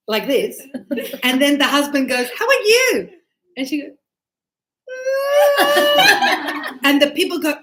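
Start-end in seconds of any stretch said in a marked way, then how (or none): 2.4-3.1 loud, high imitation of a cheery husband; husband's exuberant greeting. Husband's cheery greeting, crazy TV couple. 5.6-6.8 loud, high mock wail breaking into laughter; crying-or-laughing wail. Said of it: "Is she crying or is she laughing?".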